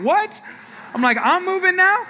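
very high, loud mock outrage; hammed-up shocked protest; startled disbelief. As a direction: squawk it in comic disbelief. Husband's startled reply, acted.

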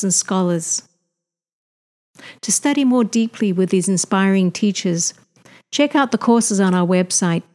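loud, polished welcome to listeners; scripted host announcement, projected and evenly phrased; podcast intro read. Acted. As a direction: read it out like a show opener.

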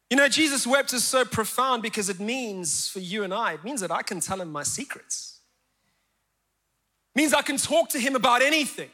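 ordinary talk

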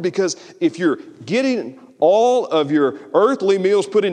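fiery sermon peak; preaching at full force. Acted. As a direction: drive it home to the congregation. Preaching climax.

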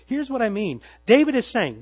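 usual speaking voice

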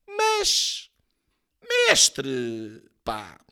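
high, drawn-out wheedling character voice; hammed-up flattering plea. A narrator's reading. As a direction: coax the master with swooping pitch. Sócrates wheedles Soares in satire.

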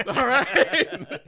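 loud, high laughing delivery; high-pitched cracking up. Real, not acted. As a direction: burst out laughing through the words. Laughing after finishing ad read.

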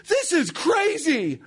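high, laughing disbelief from the booth; amazed commentator. Retold or imitated. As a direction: mimic the stunned play-by-play voice. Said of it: "And John Kelly goes".

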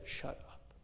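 unvoiced hush. Caleb hushing the people before Moses, a sharp whisper. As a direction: whisper it sharply to quiet a crowd. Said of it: "Basically, he said".

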